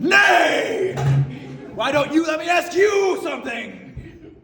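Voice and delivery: loud, high, slow laughing shout; mock defiant shout. Prankster turning Marie's question back on her, loud defiant shout. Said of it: "And I said".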